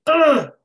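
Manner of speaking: frustrated groan. Retold or imitated. Dog about to bolt.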